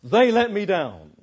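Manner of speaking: exasperated excuse-making; angry excuse. Acted. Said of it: "But I get angry. Why? Well, you say".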